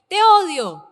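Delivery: loud, high "te odio" shout; child's angry yell. Retold or imitated. Child shouting at his mother, a loud mock yell. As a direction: yell it like a furious kid.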